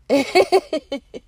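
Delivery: loud joyful laugh; loud pleased laughter; happy laugh. Real, not acted. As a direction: burst out laughing with delight. Laughs after feeling good.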